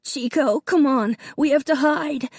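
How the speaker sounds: put-on a boy weeping as he begs the dog; sobbing child. A narrator's reading. Davy sobbing to his dog, high and urgent. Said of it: "Davy sobbed".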